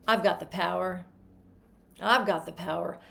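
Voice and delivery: low, flat, dejected mumble; depressing. Shown as a demonstration. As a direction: say it like you've given up. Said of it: "the most depressing thing you've ever".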